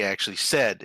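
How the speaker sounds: ordinary talk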